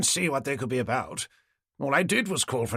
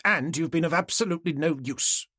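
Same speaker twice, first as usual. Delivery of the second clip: higher-pitched clipped British indignation; indignant reproach; 6 semitones higher than usual. Acted. Neighbour berates police as useless, indignant and higher-pitched. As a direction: scold the police in a raised pitch.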